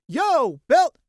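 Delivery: loud high hey-there call. Acted. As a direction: shout a cheery greeting. Calling out a greeting.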